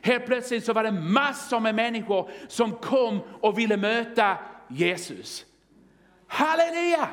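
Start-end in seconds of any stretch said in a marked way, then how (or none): 6.3-7.1 high, ringing cry of praise; exultant praise shout. Real, not acted. Preacher celebrating people coming to Jesus, exultant shout.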